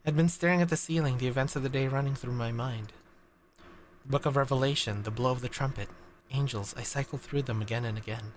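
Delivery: low, soft reading of a dark inner monologue; story narration. A narrator's reading. Streamer reads narration to chat, softly and low. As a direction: hush it down and tell it like a sleepless memory.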